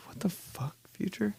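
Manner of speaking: very soft, breathy disbelief; baffled whisper. Acted. Listener puzzled by [name]'s lyric, bewildered whisper. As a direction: whisper it as if stunned by nonsense.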